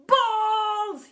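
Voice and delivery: long, high, loud cry; bellowing. A narrator's reading. Teacher pelted with balls, bellowing. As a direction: bellow and stretch the word. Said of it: "he bellowed".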